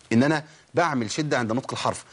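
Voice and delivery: normal talking voice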